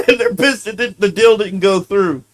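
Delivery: can't stop laughing at the absurd news; real laughter breaking into the sentence. Real, not acted. Cracking up at the news.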